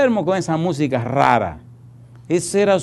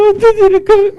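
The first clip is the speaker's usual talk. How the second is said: laughing high squeal; 8 dB louder than usual. Retold or imitated. Crying man shows the smashed bundle.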